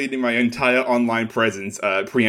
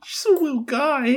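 cutesy high voice; 14 semitones higher than usual. Pretend. Cutesy voice bit.

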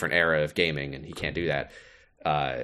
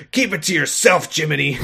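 higher, louder mock brush-off; mock-rude Sora, higher and louder; Sora snapping; 7 semitones higher, 4 dB louder than usual. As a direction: snap at him, hammed up. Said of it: "Sora being like".

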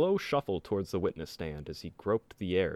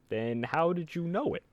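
put-on higher old-timey lawyer; 7 semitones higher than usual. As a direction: pin him down like an old-time prosecutor. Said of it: "the prosecutor in an old-timey voice".

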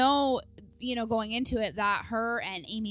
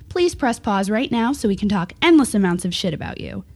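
announcer read; 7 dB louder than usual. Acted. Reads show disclaimer intro.

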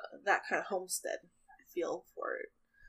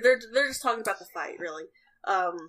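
ordinary delivery

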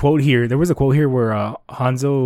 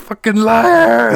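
imitating Leorio yelling liar, much louder and higher; slow, stretched-out angry yell; angry shout; 10 semitones higher, 8 dB louder, much slower than usual. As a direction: bellow it like a furious friend. Angry Leorio retold, pissed.

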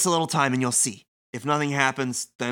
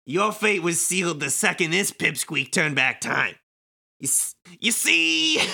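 hammy villain voice; 2 semitones higher than usual. Acted. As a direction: lean into the dramatic villain gloat. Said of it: "Kingdom Hearts level villain monologue in stupid voice".

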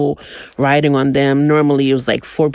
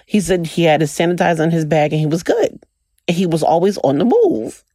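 ordinary delivery

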